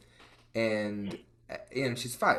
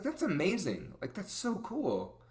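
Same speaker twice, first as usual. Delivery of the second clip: hushed, high-pitched wonder; amazed praise; 8 semitones higher than usual. Retold or imitated. Acting out her praise for child.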